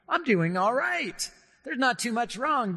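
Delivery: cheerful self-assurance, mildly acted; hint of smug relief; relieved patient. Patient relieved at doctor's exam.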